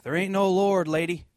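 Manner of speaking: high, loud retold heckle from next door; mocking yell. Angry atheist neighbor yelling back at the lady, loud mock yell. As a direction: bellow it back across the fence, hammed up. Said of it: "he would yell back".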